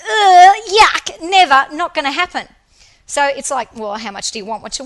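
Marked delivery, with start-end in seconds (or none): 0.0-2.5 loud, high "Ugh, yuck" of revulsion; loud grossed-out no way; disgusted refusal. Retold or imitated. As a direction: recoil at eating cockroaches. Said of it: "And I was like".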